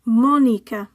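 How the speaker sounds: Mónica with MÓ stressed; stress on accented syllable. Shown as a demonstration. Teacher modelling written accents, Mónica stressed on first syllable. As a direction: say Mónica in Spanish, MÓ-ni-ca. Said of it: "stress the syllable that the accent is on".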